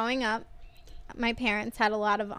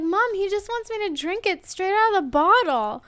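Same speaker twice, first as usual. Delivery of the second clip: put-on high, louder child voice telling on dad; whiny tattling kid; 9 semitones higher, 5 dB louder than usual. Daughter telling mom on the phone about dad, high whine. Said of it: "So I'm talking to my mom".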